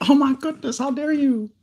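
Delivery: very high, squeaky pretend indignation; jokey mock outrage. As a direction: play being scandalised in falsetto, just kidding. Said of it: "I'm just probably joking".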